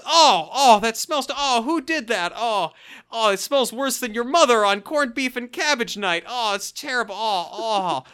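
loud, high-pitched disgusted groaning; hammed-up revulsion, wailing each "oh"; Stephen First. Dad reacting to a fart smell, exaggerated disgust. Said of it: "And Stephen First goes".

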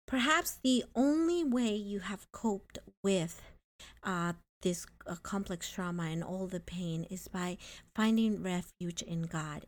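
ordinary talk, nothing marked

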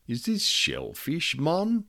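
put-on South African accent, pitched high, rising sharply on mon; a thug's South African accent, quizzical and high. A narrator's reading. Crook at the table asks in South African accent. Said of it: "His accent made me feel for the gun".

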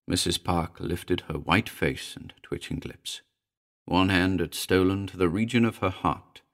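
ordinary delivery, nothing marked

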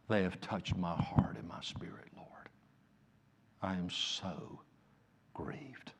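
hushed lament to the Lord; grieving whisper. Acted. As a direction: breathe the words out like a broken heart. Scripture voiced as grieving whisper.

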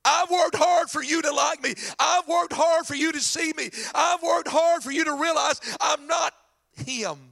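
high, shouted grievance; indignant son demanding approval; mad older son. Acted. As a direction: hammer each complaint at your father. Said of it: "let me tell you what he said".

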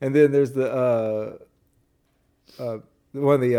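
ordinary delivery

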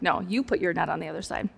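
low, quick, defiant aside. Pretend. Defiant disciple to stranger on shore, low mock refusal. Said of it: "I'm oppositionally defiant enough".

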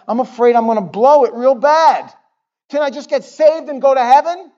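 high, loud fretful whine to God; mock panicked plea. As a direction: beg like a worried new believer. Pleading prayer complaint to God.

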